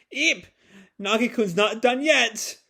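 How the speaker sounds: high startled eep, then a wary warning; lightly voiced frightened customer; mock frightened squeak.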